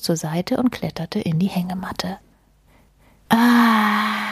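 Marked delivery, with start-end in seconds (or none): 3.3-4.2 long sighed "ah", higher than speech; contented sigh. Acted. Mole stretching out in the hammock, long sigh. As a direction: sigh out at length, settling in. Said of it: "seufzte Maulwurf".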